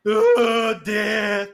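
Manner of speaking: loud, high shriek; loud possessed shriek; possessed kid. Acted. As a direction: wail it like something possessed. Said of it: "the kid goes like".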